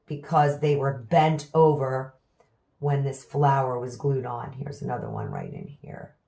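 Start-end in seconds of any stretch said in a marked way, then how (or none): 4.5-6.2 whispered to herself. Real, not acted. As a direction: breathe it out while concentrating. Focused, peering into the cactus.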